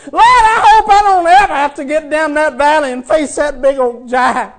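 fast, high, loud pleading prayer; mock fearful plea. Preacher pleading with the Lord in mock fear. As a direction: rush the plea out in mock panic.

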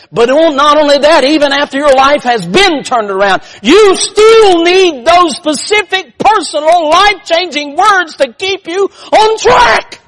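high, loud preacher's shout; full-force preaching. Real, not acted. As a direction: build to an emphatic, drawn-out shout.

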